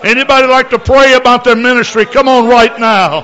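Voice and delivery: loud, high fervent altar call; preaching altar call. Real, not acted. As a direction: urge them forward with full force. Altar call at full force.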